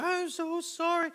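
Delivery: put-on high, slow small child's voice, welling up; tearful little girl. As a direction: voice a hurt little girl apologizing. Child in tears apologizing.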